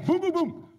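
loud, high mock door knocks; knocking sound. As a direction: bang out the knocks.

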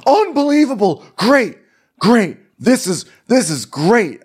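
high, sarcastic fuming; sulky exasperation in separate bursts; mock angry outburst. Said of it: "are you this angry".

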